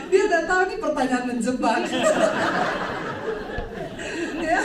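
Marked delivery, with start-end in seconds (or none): 1.9-4.4 breathless laugh; real laugh at the trick question. Real, not acted.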